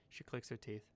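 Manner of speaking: ordinary talk, nothing marked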